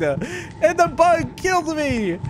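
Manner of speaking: ordinary delivery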